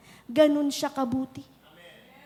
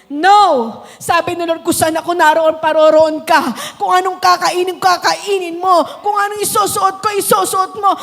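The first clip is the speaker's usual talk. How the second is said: loud, higher fervent preaching; fiery revival preaching; preaching at full force; 6 semitones higher, 11 dB louder than usual. Acted. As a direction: preach it to the rafters over music. Preaching climax continues.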